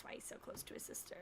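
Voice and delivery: whispered realization; whispering. Retold or imitated. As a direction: whisper it to yourself. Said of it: "I was like".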